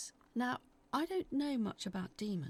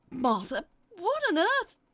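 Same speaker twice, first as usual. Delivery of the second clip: sleepy confused sister; 9 semitones higher, 12 dB louder than usual. A narrator's reading. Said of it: "she mumbled".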